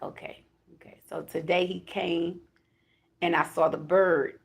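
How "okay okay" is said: a quiet whispered okay. Retold or imitated. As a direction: breathe the okays almost silent. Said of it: "I'm like".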